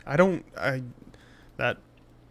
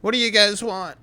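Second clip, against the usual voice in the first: put-on high child's voice; a chubby kid asking the table what they want; 8 semitones higher, 6 dB louder than usual. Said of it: "I love your kid voice".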